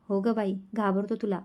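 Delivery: plain reading voice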